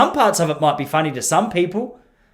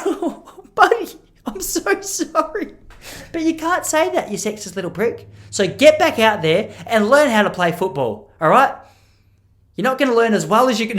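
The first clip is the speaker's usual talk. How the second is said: mock teacher scolding; 5 semitones higher than usual. Mock consoling then scolding.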